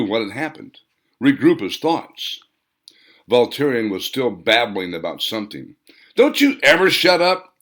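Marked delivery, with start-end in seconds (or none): 6.1-7.5 loud high exasperated bark. Acted. Hans barking at Voltarion to stop talking, loud exasperated. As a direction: bark it out, fed up. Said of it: "Hans barked".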